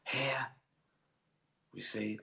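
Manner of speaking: a hushed nature-documentary narration; narrating the footage with a hint of a documentary presenter; David Attenborough.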